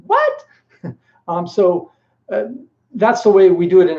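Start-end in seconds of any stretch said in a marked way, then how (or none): 0.1-0.4 a sudden, very high squeak of disbelief; shocked what. Retold or imitated. Mock stunned reply on hearing the food is from Bahrain. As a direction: jump way up in pitch on a stunned "What?".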